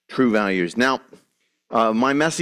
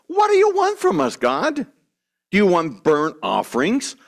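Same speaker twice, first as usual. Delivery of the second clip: high, whining complaint; whiny voice; 8 semitones higher than usual. Retold or imitated. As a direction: whine it like a spoiled child. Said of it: "asking in a whiny voice".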